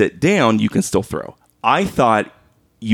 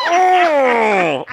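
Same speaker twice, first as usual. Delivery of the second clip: long, high mock cry of frustration; exasperated mock groan; 13 semitones higher than usual. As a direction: act out a drawn-out, high "oh" of outrage. Acted outburst after being hit.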